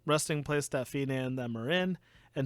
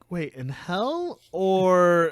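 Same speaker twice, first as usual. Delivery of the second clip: confused; 3 semitones higher, 6 dB louder, much slower than usual. Retold or imitated. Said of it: "And I was like".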